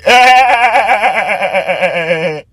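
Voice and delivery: high, drawn-out laugh; long laugh. Real, not acted. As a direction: burst out laughing and let it roll. Laughs at actor's poor accent.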